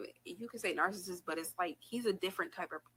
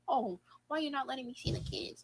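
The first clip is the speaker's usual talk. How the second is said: higher-pitched mocking imitation of playing dumb; mock stupid; 8 semitones higher than usual. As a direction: mimic him acting clueless, pitched up. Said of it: "And then acting stupid like".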